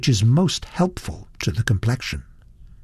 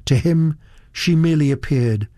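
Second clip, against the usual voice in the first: ordinary delivery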